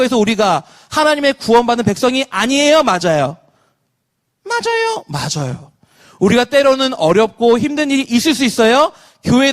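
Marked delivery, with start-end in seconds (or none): 4.4-5.0 squeaky answering voice. Acted. Pastor voicing replies to his own question, squeaky put-on voice. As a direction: answer in a tiny cartoonish squeak.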